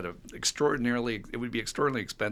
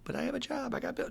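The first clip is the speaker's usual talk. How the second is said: whiny excuse; 14 semitones higher, 5 dB quieter, faster than usual. Retold or imitated. Voicing an addict's excuse.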